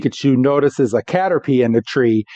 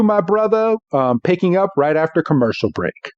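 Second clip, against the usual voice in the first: ordinary talk, nothing marked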